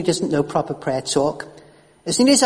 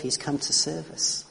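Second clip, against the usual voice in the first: usual speaking voice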